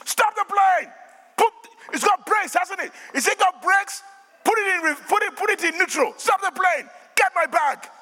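high, forceful shouted orders; retold frantic demand. Passenger ordering staff to stop the plane, high insistent shouting. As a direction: re-enact shouting urgent instructions. Re-enacting argument to halt plane.

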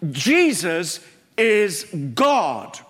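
very slow, each word weighted; slow solemn "Jesus is God"; slow emphatic declaration. Acted. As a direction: spell it out slowly and firmly.

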